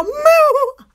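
high mock moo; mooing back. Answering the freezer from bed, high mock moo. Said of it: "and I go".

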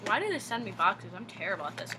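ordinary talk, nothing marked

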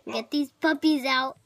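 usual speaking voice

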